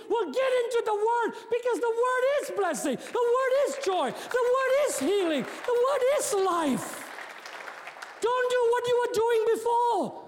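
rally the church, pushing harder each line; fiery pulpit call to change; full-force preaching. Acted.

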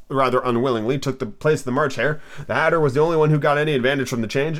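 usual speaking voice

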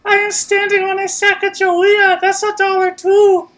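very high, loud squeaky voice. Acted. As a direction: squeal like a tiny machine. Voices coin character.

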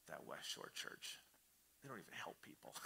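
mocking grumble. Churchgoer grumbling at home about the church, hushed mock sneer. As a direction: sulk about the church under your breath. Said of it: "we go home and we're like".

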